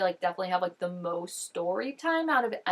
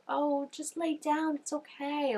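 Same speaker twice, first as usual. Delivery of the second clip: slow, lulling Southern drawl, higher; soft southern accent; 6 semitones higher, 4 dB quieter, slower than usual. Retold or imitated. A woman lulling a man into false security in a Southern accent. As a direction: soothe him to sleep with a Southern accent. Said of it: "like, southern accent where it, like, lulls you".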